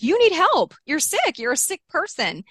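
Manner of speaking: put-on reliving the fight, shouting at her boyfriend; yelling at him. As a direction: scream it at him, then let it drop. Screaming at him in car.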